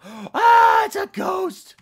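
very high, very loud scream with a gasp; frightened scream. Real, not acted. Player screaming when a ghost appears.

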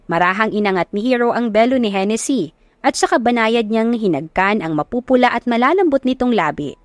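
ordinary delivery, nothing marked

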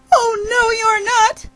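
high squealed refusal; frightened little bird's high outcry; alarmed protest. Acted. Bird protests being eaten.

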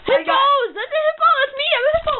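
excited shouting. Real, not acted. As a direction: yell it out giddy. Kid shouting he is a hippo.

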